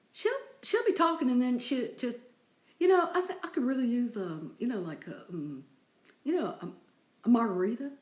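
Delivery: put-on Texan accent. Imitating a Texan friend asking for a margarita, Texan accent. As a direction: play Liz's Texan accent, stopping between words. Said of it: "she does those stops between words like that".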